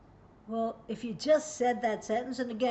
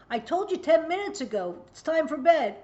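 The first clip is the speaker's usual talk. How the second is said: loud, higher exasperated yell; scolding parent; 6 semitones higher, 7 dB louder than usual. Acted. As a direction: snap at the kid who ignored you.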